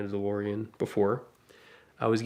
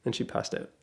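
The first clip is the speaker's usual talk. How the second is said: normal talking voice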